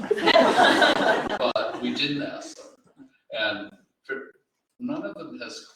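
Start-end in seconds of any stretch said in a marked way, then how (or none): 0.0-2.7 chuckling through the words, drawn out slowly; laughing. Real, not acted. Editor laughing as he admits they never asked the poets. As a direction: laugh it out as a sly confession. Joking admission, audience laughs.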